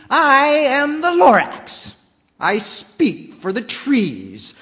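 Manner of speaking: high, loud and slow Lorax, stress on "the"; bossy Lorax voice. Acted. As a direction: announce yourself like a bossy guardian. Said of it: "spoke with a voice that was sharpish and bossy".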